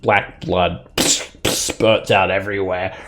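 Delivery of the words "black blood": usual speaking voice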